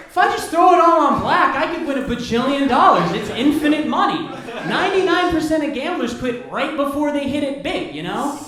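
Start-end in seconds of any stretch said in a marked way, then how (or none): 0.1-4.3 louder, higher giddy rush; manic gambler. Pretend. Broke gambler hyping himself up, louder and giddy. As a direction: sell yourself the dream of winning.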